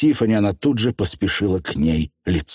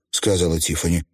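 ordinary delivery

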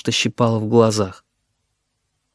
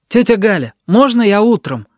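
put-on high boyish plea; pleading; 9 semitones higher, 4 dB louder than usual. A narrator's reading. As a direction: call back, begging to put it off. Boy pleads across the flat. (просительно, высоким мальчишеским голосом)

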